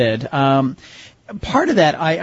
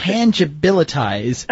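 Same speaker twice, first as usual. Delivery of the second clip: slow, careful tongue twister; slowly, carefully; slower than usual. Shown as a demonstration. Host sounding out a tongue twister, slow and careful. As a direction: draw out TANGIBILITIZE syllable by syllable. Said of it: "I have to say this word slowly".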